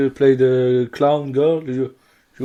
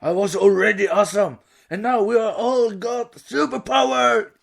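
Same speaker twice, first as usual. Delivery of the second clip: Rainbow Dash voice; 6 semitones higher than usual. Acted. Voicing Rainbow Dash quote.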